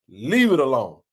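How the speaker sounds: normal talking voice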